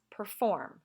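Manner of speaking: per-FORM, weight on FORM; stress on second syllable. Shown as a demonstration. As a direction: lean on the second syllable of Perform. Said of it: "It's the second syllable that we tend to stress".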